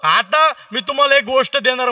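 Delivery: high, loud blessing voice; pleased sadhu. Acted. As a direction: announce your favour grandly. Sadhu blesses old man.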